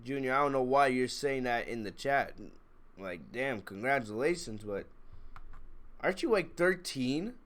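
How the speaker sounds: ordinary delivery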